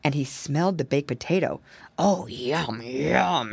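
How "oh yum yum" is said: a hint of a greedy fox savouring; lightly voiced fox licking his chops; mild relish, yum yum. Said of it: "he cried".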